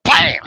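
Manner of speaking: sudden high shouted bam; slap sound effect. Retold or imitated. Describing Storm slapping Emma, a high shouted bam. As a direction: smack the word out like a slap landing. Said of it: "just like".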